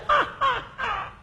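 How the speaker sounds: very high, thin cackle fading out; mocking demon laugh. Demons laughing at the damned soul, mock high cackle. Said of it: "los demonios se reían de mí".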